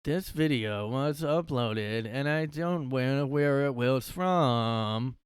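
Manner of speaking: mock narrator. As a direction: stretch every word like the creepy narrator. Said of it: "if somebody talked like you".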